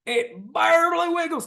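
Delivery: high sneering mock quote; high jeering "huh, it barely wiggles". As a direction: jeer it like a snarky commenter. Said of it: "saying like".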